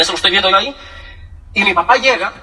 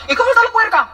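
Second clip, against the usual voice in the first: high-pitched comic country caller; hammed-up nosy small talk over the line; gossiping villager; 11 semitones higher than usual. Villager hogging the phone booth, comic chatter.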